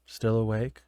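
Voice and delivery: low, quiet, dead monotone; flat monotone. Shown as a demonstration. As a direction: say it like after a nineteen-hour shift. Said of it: "It was like".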